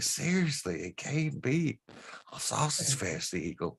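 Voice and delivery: put-on high Southern accent, insistent. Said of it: "real Southern accent".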